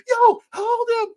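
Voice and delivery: very high, loud, slow mock yell; mock shout. Host imitating a cop yelling in a video, very high and loud. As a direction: bellow it like a frantic cop. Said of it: "the cop comes up and is like".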